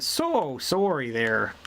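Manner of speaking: slow, low East Coast "So sorry there"; East Coast Canadian. Shown as a demonstration. Explaining East Coast speech, slow low East Coast sorry. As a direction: drawl the "sorry" like a Maritimer. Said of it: "East Coast more".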